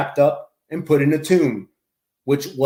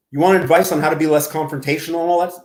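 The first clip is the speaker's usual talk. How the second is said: normal talking voice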